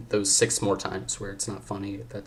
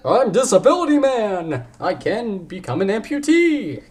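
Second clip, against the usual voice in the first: higher, louder superhero intro, hammed-up; mock superhero announcement; 10 semitones higher, 8 dB louder than usual. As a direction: boom it out like a comic-book hero introducing himself.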